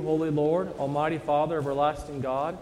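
ordinary talk, nothing marked

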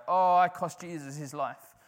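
mock mopey wail, slowed right down; mock moping lament. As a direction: drag it out like a moper. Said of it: "moping and going".

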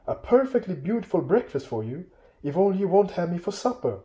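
high, anxious, polite little voice; pleading hobbit. Acted. Bilbo begging trolls not to cook him, high pleading. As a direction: beg sweetly for your life in a high voice.

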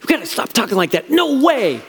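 high, urgent shushing rebuke; scandalized disciple, hurried and high; alarmed Peter. Acted. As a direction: hush him in high-pitched disbelief. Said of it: "Peter's like".

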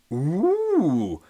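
whispered high ooh; Potty Mouth Paul impressed; cheeky villager. Acted. Paul's reaction.

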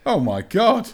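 high-pitched, real astonishment; amazed exclamation. Real, not acted. Hears of first-round knockout.

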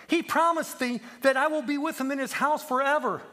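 put-on high, childlike homesick plea; tearful pleading. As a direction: plead through tears, slowing at the end. Crying lamb pleads and recalls promise.

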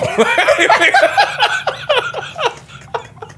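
high burst of laughter. Real, not acted. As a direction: crack up laughing. Big laugh at the story.

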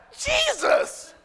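high shouted gasp of a name; shocked cry. Pretend. Pastor imagines seeing Jesus flip tables, shocked shout. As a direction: cry out his name in disbelief. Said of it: "I would have been like".

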